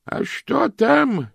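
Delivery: put-on high, slow, cracked old lady. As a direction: croak like a tiny ancient aunt. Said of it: "послышался старый голос".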